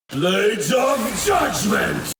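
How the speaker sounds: high, drawn-out battle shout; attack call. Acted. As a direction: call down your attack with full force. Calling out an attack.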